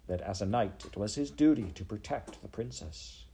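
usual speaking voice

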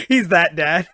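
ordinary talk, nothing marked

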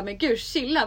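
taken-aback protest. Acted. Reacting to two guys shouting at her, startled protest. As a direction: act out telling someone to calm down.

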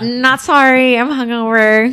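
high, loud blunt confession; hungover. Retold or imitated. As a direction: flippant not sorry, hungover. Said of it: "so sorry".

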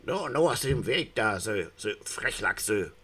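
put-on rowdy Viennese drunk yelling; bellowing eel. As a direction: bellow at the young salmon. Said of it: "brüllte ein Aal plötzlich".

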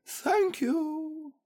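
very high, squeaky little voice; overcome thanks. A narrator's reading. Pooh thanking friends for his present, high overcome squeak.

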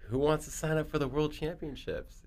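high, far-off announcer voice; cheery announcer. Retold or imitated. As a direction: chirp it like an eager race host. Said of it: "they're like".

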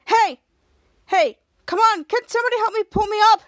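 loud, high shout for attention; acted exasperated yell for help; calling for help. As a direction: call out to people who are ignoring you. Dangling man calls for help.

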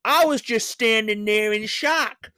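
hammed-up American drawl of the lottery winner; American accent. Reading out the winner's quote in a mock American accent. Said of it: "I'm gonna do it".

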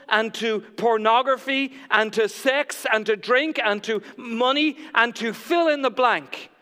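loud, emphatic list of confessions; forceful preacher voicing testimonies; bold confession. Voices confessions in recovery meeting.

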